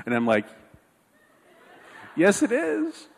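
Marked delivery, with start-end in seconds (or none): 2.2-3.1 very high, strained little agreement; fake enthusiasm. Scared dad agreeing with daughter, falsely keen squeak. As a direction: squeak out a yes you don't mean.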